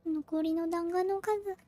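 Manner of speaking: ordinary delivery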